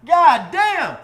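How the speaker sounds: high, loud awed exclaim; awed outburst; amazed hype. Retold or imitated. Seeing the mansion, loud awed outburst.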